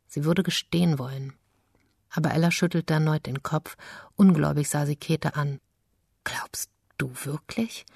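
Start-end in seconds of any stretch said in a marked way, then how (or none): 6.2-7.9 slow, soft whisper; disbelieving daughter whispering to her accusing mother. Acted. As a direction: breathe the question in disbelief.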